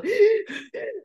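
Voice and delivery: laughing gasp. Retold or imitated. Recalling being hysterical.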